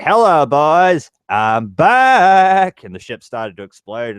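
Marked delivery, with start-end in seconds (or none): 0.0-2.8 higher, louder, slow heroic announcement; movie hero line. Retold or imitated. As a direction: belt it out like a triumphant fighter pilot. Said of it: "And he's like".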